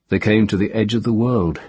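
ordinary delivery

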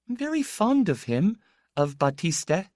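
thin, high, sweetly modulated; a young lady's high, sweet tones. A narrator's reading. Young woman speaking fondly of the boy, thin sweet voice. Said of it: "a young lady voice".

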